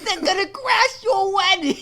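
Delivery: chittering raccoon shouting out; Rocket Raccoon voice. Pretend. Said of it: "He does the Rocket Raccoon voice".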